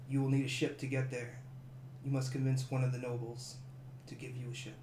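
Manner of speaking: soft, low-volume monk voice; a quiet monk's caution; hushed monk warning. Acted. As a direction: warn them quietly, gravely.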